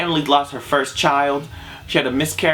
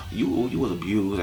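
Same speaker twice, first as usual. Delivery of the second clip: much softer, "abused" let go gently; soft, sad repeat; 5 semitones lower, 7 dB quieter than usual. Shown as a demonstration. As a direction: drop to a pitying hush on "abused". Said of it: "repeated much softer".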